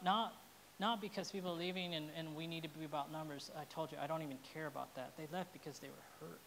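normal talking voice

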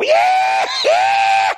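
loud imitated whooping yell; tickled man's holler. Preacher imitates his friend getting tickled, huge holler. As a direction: whoop it out at full volume. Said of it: "He'd get tickled and he'd go".

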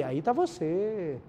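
slow, hushed hello to a newborn; soft slow wonder at first sight; tender greeting. Retold or imitated. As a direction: meet the baby gently, slowly. Father meets newborn daughter.

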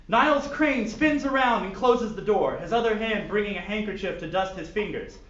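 lively storyteller read; story narration. Acted. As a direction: narrate it like a silly audiobook. Reads fan fiction aloud.

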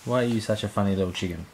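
ordinary talk

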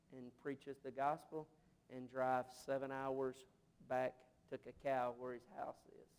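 low, soft preacher's narration; subdued, reverent telling; hushed sermon storytelling. Real, not acted. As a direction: bring it right down after the climax, with long pauses. Hushed storytelling after the shouted climax.

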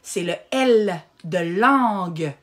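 slow, clear "L de langue" with the L stretched; teacher spelling out "L" slowly; drawn-out L. Shown as a demonstration. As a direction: model "C'est le L de langue" slowly for the child. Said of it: "on l'approche de la bouche".

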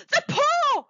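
very high, loud sudden outcry; wild cry. A narrator's reading. Wife waking her husband with a sudden idea, wild cry. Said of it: "she cried wildly".

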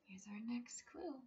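soft secretive whisper; conspiratorial whisper to kids; whispered clue. Acted.

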